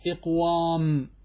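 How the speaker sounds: إقوام said slowly, each sound drawn out; slow demo form. Shown as a demonstration. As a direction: spell out إقوام slowly for students. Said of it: "كان يجب أن يكون مصدره".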